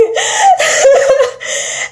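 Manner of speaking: high pained cackle; shrieky laugh. Retold or imitated. Friend laughs at herself after ripping her nail, shrieking. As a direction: laugh through the hurt.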